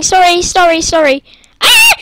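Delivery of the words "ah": short, very high loud shriek; scream. Real, not acted. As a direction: let out another startled scream. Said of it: "Sorry for screaming".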